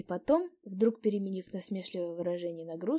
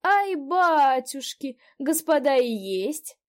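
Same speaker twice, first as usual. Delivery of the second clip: put-on old woman; 6 semitones higher, 11 dB louder, much slower than usual. A narrator's reading. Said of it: "послышался голос старухи".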